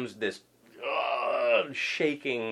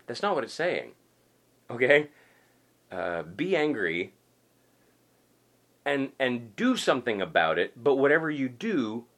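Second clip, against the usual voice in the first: ordinary talk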